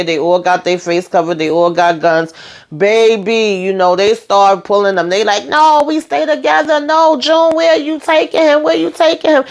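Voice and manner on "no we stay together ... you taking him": high, frantic pleading as June is dragged away; panicked pleading. Retold or imitated. Recapping the show, voicing June's panic as guards pull them apart. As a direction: cry out desperately for him.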